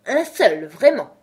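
loud echo, jumping up in pitch; indignant. A narrator's reading. Dormouse taking offence at Alice's concession, indignant. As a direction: throw it back, affronted. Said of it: "s'exclama le Loir d'un ton indigné".

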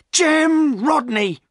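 high, loud, drawn-out name; accusing cry. A narrator's reading. Silas to the man he suspected, accusing cry. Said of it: "cried Silas, turning and staring".

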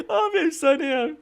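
high, drawn-out words after laughing; amused. Real, not acted. Player delighted by the game, amused high voice. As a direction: say it still tickled from a big laugh.

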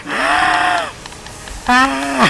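high imitated death scream; death cry. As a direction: mimic the enemies dying with a squeal. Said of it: "they like do like a death scream".

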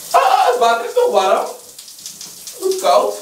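shrieking at icy water; cold water shock. Real, not acted. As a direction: yelp high from the cold.

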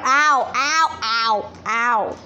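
put-on "ow" yelps; repeated yelps. Owner after remark on camera-shy dogs, repeated yelps. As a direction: yelp it over and over in an altered voice.

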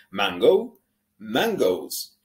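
"mangoes" with an American English ow ending; American English accent. Shown as a demonstration. Teacher showing American English accent on fruit names. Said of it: "Con acento ya de inglés americano".

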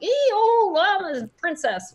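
put-on high, slow, dainty old-timey princess voice; cartoon princess. Shown as a demonstration. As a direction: squeak it out like a storybook princess. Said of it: "like, an old-timey, like".

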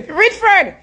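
pleading falsetto mock of a wounded lover; mock Aisha. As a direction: squeak it out like a lovesick woman moaning to her man. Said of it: "We tap use a voice, they can say it annoying".

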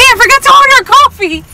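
calling out. Real, not acted. Remembering a coffee at the drive-thru, calling out. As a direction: blurt it out to get attention. Calls out about forgotten order.

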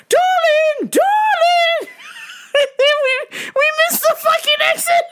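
high lilting mock mom voice; mom's voice. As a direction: sweetly call like mom, high. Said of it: "my mom's like".

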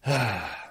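a long, audible sigh let out softly; a soft empathetic sigh after listening; demo sigh. Shown as a demonstration. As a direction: breathe out a slow sigh to let it land. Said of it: "you follow it by a sigh".

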